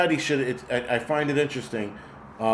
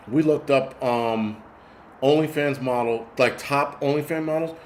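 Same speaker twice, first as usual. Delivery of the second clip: ordinary talk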